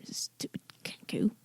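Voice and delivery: whispered insult. Acted.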